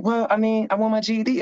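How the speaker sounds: a lightly voiced curious fellow inmate; curious inmate friend. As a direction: voice pitched well up, asking eagerly. Friend voiced in a prison story.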